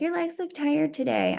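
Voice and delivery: mocking coach. Mocking coach's useless comment.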